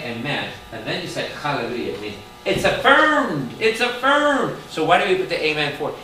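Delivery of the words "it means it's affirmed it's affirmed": high, loud stress on affirmed; affirmed rising loud and high; emphatic. Real, not acted. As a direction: hammer the word affirmed home. Emphatic preaching point.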